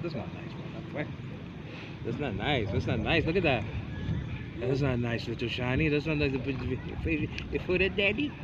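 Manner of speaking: soft, low gentle telling-off; soft scolding. Real, not acted. Gently scolding baby for kicking.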